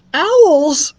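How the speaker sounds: high, loud startled cry; sudden fear of owls; alarmed mouse. Acted.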